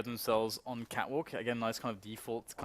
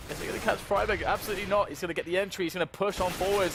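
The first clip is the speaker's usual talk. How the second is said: excited call; 9 semitones higher, 6 dB louder than usual. Real, not acted. Hyped play-by-play call.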